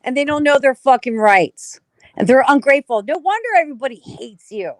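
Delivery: loud, heated outburst; angry. Real, not acted. Venting to the audience about ungrateful Americans, loud anger. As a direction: hammer it home in real anger. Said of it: "I'm getting angry".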